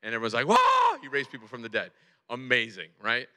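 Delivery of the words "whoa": high, loud crowd "whoa"; amazed shout. Acted. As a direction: let out an astonished, high-pitched whoa. Crowd amazed at Lazarus raised.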